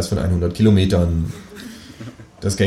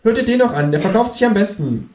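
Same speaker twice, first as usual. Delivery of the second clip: high cheeky kangaroo; 14 semitones higher than usual. Acted. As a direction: answer like the cocky kangaroo.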